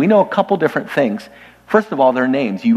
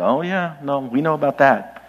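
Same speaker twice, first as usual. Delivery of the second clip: mock offhand congregation reaction, a bit softer; knowing murmur; 4 dB quieter than usual. Church members hearing the letter read, lightly acted knowing reaction. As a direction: play unsurprised listeners who know already.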